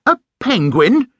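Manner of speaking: high, loud shocked echo; indignant exclaim. A narrator's reading. Paddington upset at the penguin remark, high indignant exclaim. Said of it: "exclaimed Paddington".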